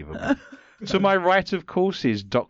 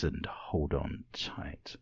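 whisper; 9 semitones lower, 12 dB quieter, much slower than usual. Acted. Spooky host intro.

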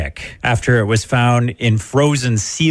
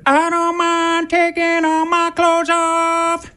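very high, slow falsetto rock star; slower than usual. Retold or imitated. Host mocks the shirtless halftime singer in falsetto. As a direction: croon it like a vain singer showing off. Mocking [name] singing.

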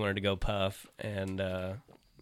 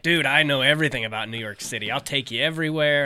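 loud, higher, cocky Craig impression; imitating eager Craig; 5 semitones higher, 8 dB louder than usual. Mimicking his buddy boasting he knows the city, loud and cocky. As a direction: brag it like an overeager buddy. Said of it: "He was like".

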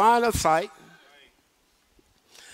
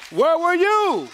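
full-force preaching; 4 semitones higher, 3 dB louder than usual. Acted. Preacher to congregation, full-force preaching. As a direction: thunder the question at the congregation.

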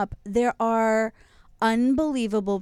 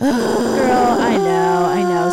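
loud exclaim; 4 semitones higher, 10 dB louder, much slower than usual. Real, not acted. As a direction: belt it out in disbelief. Reacting to shocking news.

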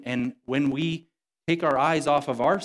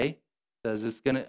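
usual speaking voice

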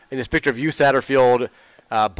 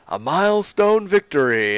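corny announcer call; 7 semitones higher, slower than usual. Retold or imitated. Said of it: "his just corny-ass calls".

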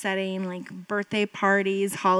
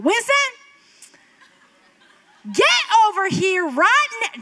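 sharp, much higher, louder yell of a name; frustrated call; 10 semitones higher, 4 dB louder than usual. Retold or imitated. Mother calling her two-year-old in frustration, mock angry yell. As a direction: bark the name at a misbehaving toddler. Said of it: "when I call his name out of aggravation or frustration".

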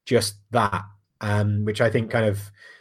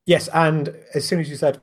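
usual speaking voice

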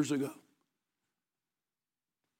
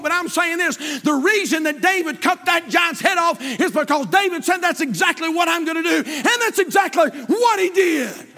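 preaching at full force; 9 semitones higher than usual. Acted. Pastor to congregation, fired-up preaching shout. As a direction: rouse the church with a shouted climax.

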